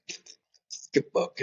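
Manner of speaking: high, strained, voiceless mock yell; squeezed high whisper-yell, held back; imitating frantic daughter. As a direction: mimic a daughter fighting for her bag. Retold daughter fighting for her pocketbook.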